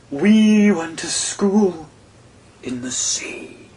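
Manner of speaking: very slow, high, fading to a whisper at the end; slow sad turtle voice trailing into a whisper; slow mournful turtle. A narrator's reading. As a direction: draw out each word sadly, then drop away. Mock Turtle tells slowly.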